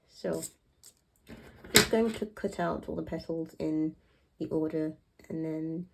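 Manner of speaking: ordinary talk, nothing marked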